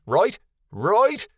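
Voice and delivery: second right rising high and unsure; hopeful checking. A narrator's reading. Kasif looking to Kia and Kyoto for support, rising hopeful ask. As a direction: fish for agreement, doubt creeping in. Seeking support, gets none.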